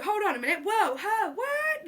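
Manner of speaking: high, startled disbelief; shocked. Retold or imitated. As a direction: react like you can't believe it. Shocked reaction to ending.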